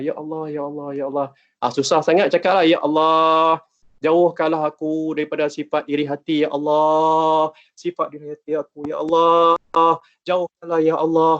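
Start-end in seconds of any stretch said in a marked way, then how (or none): 0.0-1.3 a hushed, inward calling on God; quiet prayer murmur. Shown as a demonstration. Teacher softly demonstrating a prayer to students, hushed murmur. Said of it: "kita kena sentiasa berdoa". 2.7-11.3 drawn-out, earnest supplication, stretching each Allah; pleading prayer. Shown as a demonstration. Teacher demonstrates a heartfelt prayer against envy, earnest pleading. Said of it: "Ha, macam tu".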